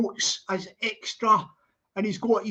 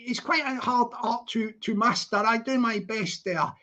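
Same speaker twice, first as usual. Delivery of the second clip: ordinary talk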